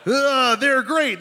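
a hint of a cartoon tiger; lightly voiced cereal mascot; Tony the Tiger impression. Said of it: "That's not him".

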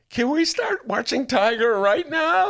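high, loud, slow begging whine; whiny plea. Retold or imitated. After the New York Times, pleading for Tiger King. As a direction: plead like a kid for TV. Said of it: "I was like".

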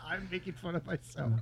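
laughing through the words. Real, not acted. Guest laughs at his own bit, laughing. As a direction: chuckle it out at yourself. Laughs while explaining joke.

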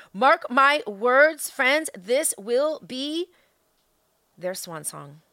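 slow, loud emphatic warning. Real, not acted. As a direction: drag each word out. Solemn warning to listeners.

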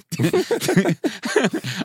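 a real laugh breaking out high; high burst of laughter. Real, not acted. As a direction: crack up at your own joke. Laughs at Audi A4 joke.

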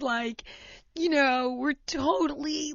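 ordinary delivery

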